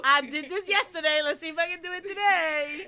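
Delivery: high, loud imitated kid boast; excited little kid. Fan imitating drummer showing off, excited little-kid voice. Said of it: "Like a little kid with the play set".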